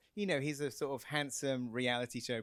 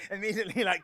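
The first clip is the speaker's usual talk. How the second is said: laughing; 9 semitones higher, 6 dB louder, faster than usual. Real, not acted. Laughing at Arnold's remark.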